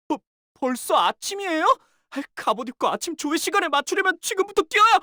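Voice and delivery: high, hurried alarm at oversleeping; startled panic. Acted. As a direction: jolt awake and scramble to get out. Overslept, rushing to morning roll call.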